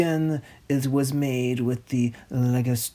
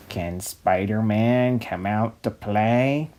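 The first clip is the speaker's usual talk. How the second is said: lower, taunting villain voice; sinister villain voice; 5 semitones lower than usual. Acted. Green Goblin taunting Spider-Man from the ruins, lower sinister voice. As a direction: tease it like a lurking goblin.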